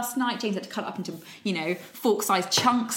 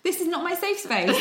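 indignant squeak; 11 semitones higher, 4 dB louder than usual. Retold or imitated. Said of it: "I was just like".

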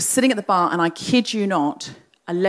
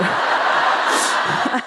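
breathy little laugh under the breath; 3 semitones higher than usual. Real, not acted. Laughing at own low point.

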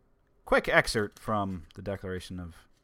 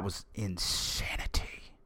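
hushed, breathy aside, slowed down; whisper; 2 semitones lower, 11 dB quieter, slower than usual. Real, not acted. Host to listeners about double shifts, whispered. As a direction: whisper it like it still haunts you. Recalling a brutal week.

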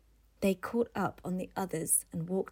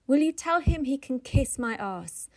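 scornful outburst; 6 semitones higher, 7 dB louder than usual. Acted. Editor dismisses the ad.

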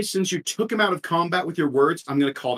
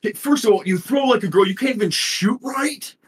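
high mocking troll jeer; mock her aim, pitched up; taunting troll; 7 semitones higher than usual. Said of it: "it's like".

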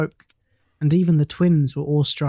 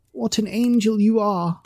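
higher, fervent outcry; a mildly voiced heartfelt cry; cried out; 6 semitones higher than usual. A narrator's reading. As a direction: cry it out in delight. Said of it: "cried the young Duke".

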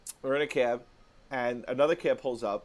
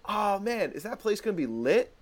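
put-on excited girl; 8 semitones higher than usual. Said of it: "and she goes".

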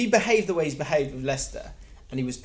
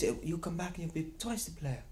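reassuring Guardiola; 3 semitones higher, 11 dB quieter than usual. Retold or imitated.

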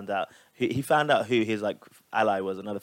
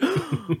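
high gasp of pretend shock; mock shocked gasp. Imitating a mum having flashbacks, mock shocked gasp. Mimicking a shell-shocked reaction.

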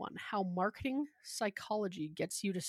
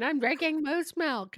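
slow, high, loud moose-milk brag; mock slurred boast; 8 semitones higher, 7 dB louder than usual. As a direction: brag it slowly like a tipsy friend. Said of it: "Carla's in the closet going".

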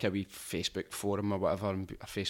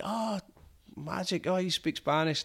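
delighted guide; 9 semitones higher, slower than usual. Retold or imitated. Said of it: "he's like".

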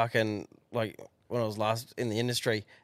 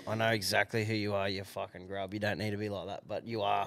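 inner grumble; 4 semitones lower, 6 dB quieter than usual. Retold or imitated. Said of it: "I'm going".

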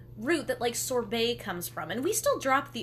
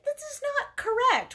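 mock outrage; 13 semitones higher, 4 dB louder than usual. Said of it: "I'm like".

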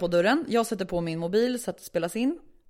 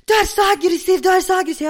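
high, loud, repeated outcry; shrill accusation; 10 semitones higher, 10 dB louder than usual. Retold or imitated. Neighbour accusing tenant mid-quarrel, loud and upset.

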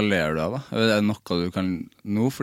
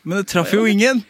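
higher, louder outraged cry; indignant exclamation; 12 semitones higher, 6 dB louder than usual. Real, not acted. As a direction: exclaim it in disbelief. Outraged reply about bad show. (høy, indignert utbrudd)

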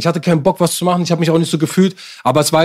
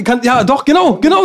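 normal talking voice

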